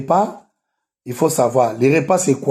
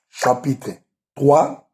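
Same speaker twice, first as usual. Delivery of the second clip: ordinary talk, nothing marked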